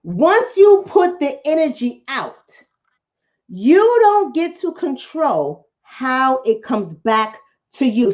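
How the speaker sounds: slowed right down, every word laid out; slow emphatic repeat. Shown as a demonstration. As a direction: spell it out slowly so it lands. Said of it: "Let me say that again".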